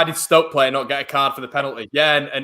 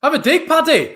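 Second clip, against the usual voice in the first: high, loud urging yell at the screen; excited shout; 5 dB louder than usual. Real, not acted. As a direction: cheer the player on to shoot. Urging player to shoot.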